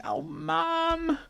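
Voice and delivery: very high, embarrassed kitten whine; whiny kitten. A narrator's reading. Ginger groaning at mum licking his ears, high whine. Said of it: "He's going".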